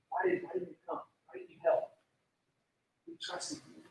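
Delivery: breathy, hushed, breaking plea; distraught question. Acted. Mary pleading with Jesus in hushed distraught grief. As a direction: ask it like your heart is broken.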